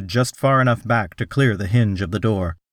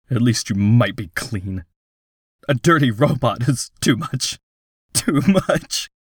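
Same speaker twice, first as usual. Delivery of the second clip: tearful voice. Acted. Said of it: "he heard tears in his voice".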